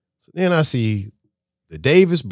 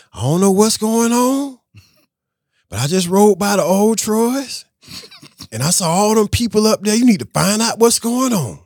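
mimicking his assistant; 12 semitones higher, 5 dB louder than usual. Assistant calling with news, high and urgent. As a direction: lift the voice high and draw it out. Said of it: "she says".

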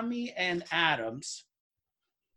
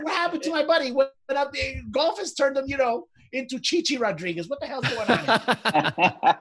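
high, incredulous outburst; high disbelief; 8 semitones higher than usual. Retold or imitated. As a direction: yelp it like you can't believe it. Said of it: "I said".

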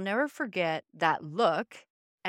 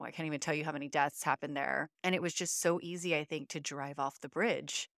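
usual speaking voice

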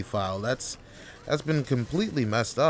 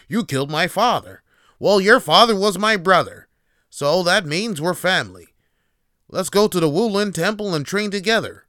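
loud, higher, hammed-up dubbed dialogue; bad kung fu dub; 6 semitones higher, 8 dB louder than usual. Mock kung fu movie characters in a terrible dub, loud and hammy. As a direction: overact like a badly dubbed eighties movie. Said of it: "terrible dubbed".

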